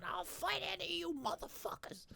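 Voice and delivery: high, softened faraway screech; mock yelling woman. Woman with a litter box challenging the street, mock high yell. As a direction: imitate a crazed street challenge, pitched high and held back in volume.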